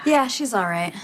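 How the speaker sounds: fails to keep a straight face; laughing through the words; breaking into laughter. Acted. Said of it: "trying to say that with a straight face".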